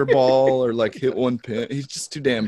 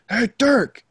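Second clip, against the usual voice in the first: high, loud hail across the room; calling out Dirk; 13 semitones higher, 5 dB louder than usual. Retold or imitated. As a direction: shout his name to call him back. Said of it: "And he goes".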